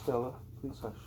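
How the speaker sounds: quiet off-mic hush to Stella; hushed aside. Real, not acted. Reader turns from the mic to quietly hush Stella. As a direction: drop the voice to hush someone nearby.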